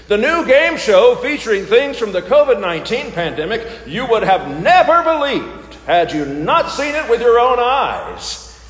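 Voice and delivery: upbeat TV host pitch; game show host. Pretend. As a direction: sell it like a quiz show host. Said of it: "my best game show voice".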